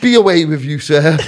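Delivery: loud, mock-grand dismissal; giving it beans. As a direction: send him off with hammed-up bravado. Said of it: "I started giving it beans".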